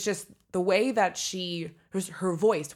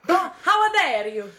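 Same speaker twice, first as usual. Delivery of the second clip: mock outrage; 11 semitones higher, 6 dB louder, much slower than usual. Mock offense at accent critique.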